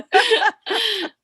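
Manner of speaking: a loud, high, happy laugh; high bright laugh. Real, not acted. Delighted laugh after praising accent.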